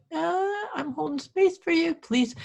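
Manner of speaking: high-pitched mock patience; high-voiced pleading. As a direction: plead gently up in head voice. Acts out her response to clients.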